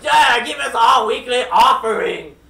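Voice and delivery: the aliens demanding their tribute in a put-on voice. Aliens demand their weekly offering from Joe, in a slow, booming put-on voice. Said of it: "they were like".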